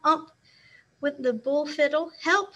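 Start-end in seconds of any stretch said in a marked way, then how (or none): 2.2-2.6 higher mock scream for help; clerk's narrated scream, lightly acted. A narrator's reading. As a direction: cry out in alarm. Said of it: "screamed a clerk".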